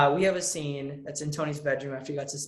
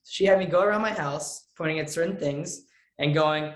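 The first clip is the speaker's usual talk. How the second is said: ordinary delivery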